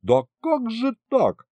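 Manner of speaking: high, drawn-out disbelief; high, stretched incredulous question; dismayed. A narrator's reading. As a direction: protest in baffled dismay. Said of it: "сплеснул я руками".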